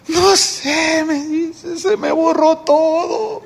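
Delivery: a lightly played ashamed, weeping man; tearful brother. Ashamed brother answers, crying. (hermano avergonzado, lloroso y lento)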